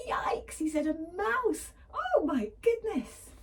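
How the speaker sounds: high, loud panicky exclaim; terrified lion. Acted. Lion spots the mouse and panics, high and loud. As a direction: squeal it out in hammed-up panic. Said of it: "The lion was terrified".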